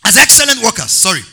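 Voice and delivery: loud, high-pitched sermon delivery; rousing pulpit cry to the congregation; full-force preaching. Real, not acted. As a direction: preach it loud, stretching the key words.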